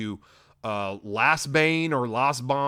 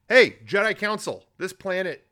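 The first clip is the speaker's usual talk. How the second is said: mock calling out; 6 semitones higher, 4 dB louder than usual. Calling out to the council.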